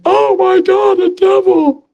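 mock panic. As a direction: squeal like a scared believer. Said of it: "That sure beats going".

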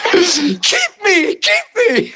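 very high little pleading voice; eager fish voice. Pretend. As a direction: squeak like a fish begging to be caught. Voices fish leaping into the boat.

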